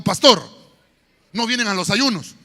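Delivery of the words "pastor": shouted pastor; mock shout. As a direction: call it out loud. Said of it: "diga conmigo, aquí no hay ninguno". (gritando pastor)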